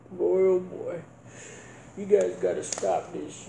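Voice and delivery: ordinary talk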